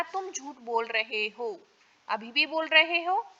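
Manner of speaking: normal talking voice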